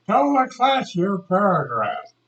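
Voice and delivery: lightly voiced commanding teacher; teacher's order. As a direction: call on a pupil in front of the class. Teacher retold calling on pupil.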